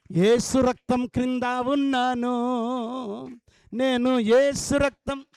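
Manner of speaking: loud, slow proclamation; booming pulpit declaration; preaching at full force. Acted. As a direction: declare it to the whole hall.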